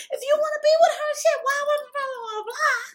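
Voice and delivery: high, fast, raging shout; furious yelling. Retold or imitated. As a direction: go off on him. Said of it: "I'm going off".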